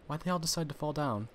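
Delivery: ordinary talk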